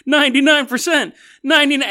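rally the masses against the rich; mock protest chant; defiant crowd shout. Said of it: "the steerage people are like".

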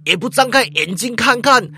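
shouting thug, no longer casual; angry yell. Acted. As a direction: bark it at the darkness. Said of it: "骂道".

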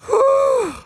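high-pitched exhaled whew; relieved whew. Real, not acted. Dad finishing his close-call story, relieved whew. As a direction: let out a long, high phew.

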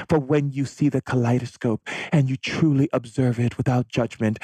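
high, loving motherly voice; mother's voice. Retold or imitated. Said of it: "that's how my mom talks".

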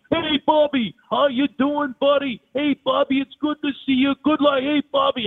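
mock Vitale yelling; Dick Vitale impression. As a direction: burst in hollering like an over-the-top TV announcer. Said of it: "yelling and screaming at Bobby".

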